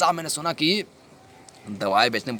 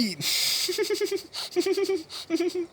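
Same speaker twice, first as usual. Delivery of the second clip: high-pitched laugh in bursts; gloating laugh; 3 dB quieter than usual. Acted. Dealer revelling in becoming a millionaire, gloating laugh. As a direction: laugh long and high in triumph.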